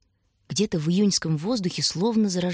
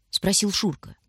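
ordinary delivery

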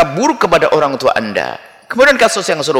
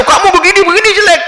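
high mocking insult, pitched way up; mocking taunt; 4 dB louder than usual. Preacher mimics someone jeering at a sibling, high and mocking. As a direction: squeal it like a jeering bully.

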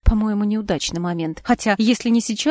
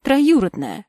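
Троюродная stressed higher and louder; emphasized; 6 semitones higher, 4 dB louder, slower than usual. A narrator's reading. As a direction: lean hard on Троюродная. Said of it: "выделила голосом я". (с нажимом на «Троюродная»)

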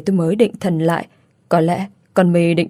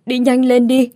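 higher and louder đi nhanh lên đi; frantic plea; 6 semitones higher, 4 dB louder than usual. A narrator's reading. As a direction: push the final plea up in panic. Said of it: "run rẩy nói".

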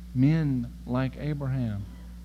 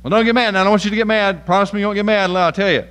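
louder, higher coaxing plea; butter her up before the bad news; husband coaxing wife; 6 semitones higher, 11 dB louder, faster than usual. Husband warns wife before bad news, loud coaxing. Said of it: "you're talking to your wife and you go".

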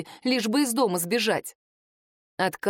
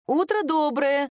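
higher, slow called greeting; calling out; 6 semitones higher, slower than usual. A narrator's reading. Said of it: "крикнула в тишину квартиры".

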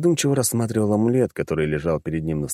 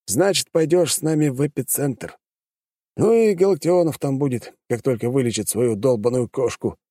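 smirking; 6 semitones higher than usual. A narrator's reading. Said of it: "усмехнулся Пушкин".

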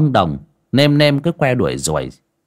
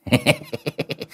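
high cheeky hee-hee giggle; mischievous falsetto snicker; hee-hee laugh; 5 dB quieter than usual. Acted. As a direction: giggle like a naughty kid who got away. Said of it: "cười hè hè".

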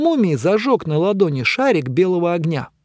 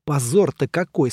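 ordinary delivery, nothing marked

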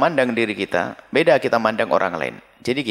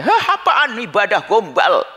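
scornful mocking quote; 7 semitones higher, slower than usual. Mocking a pious hypocrite.

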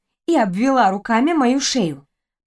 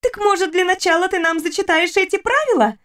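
put-on cheeky girl voice; 8 semitones higher than usual. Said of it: "бросила Фриза".

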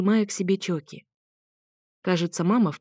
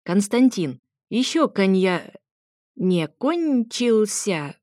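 put-on a tipsy old lady stretching out her words, louder; tipsy grandma; 3 semitones higher, 3 dB louder, much slower than usual. A narrator's reading. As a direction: stumble over the word like a merry grandma who has had a drink. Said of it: "перефразировала ба". (весёлая подвыпившая бабуля, растягивая слова)

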